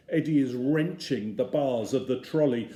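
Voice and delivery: usual speaking voice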